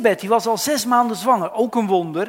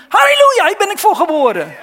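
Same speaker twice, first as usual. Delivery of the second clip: joyful shout; 11 semitones higher, 12 dB louder than usual. Acted. Unborn John rejoices.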